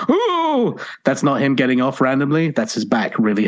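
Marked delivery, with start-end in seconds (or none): 0.1-0.8 high pained ooh; ghostly ooh. Retold or imitated. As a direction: imitate a back spasm moan. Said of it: "You may hear him periodically go".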